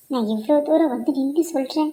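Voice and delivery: ordinary talk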